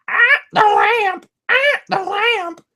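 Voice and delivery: squawky high parrot echo. Retold or imitated. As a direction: screech it back like a parrot.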